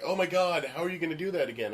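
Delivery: mock panic, rushing the question; freaking out. Emily worried about redoing the scene, freaking out. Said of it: "she's freaking out".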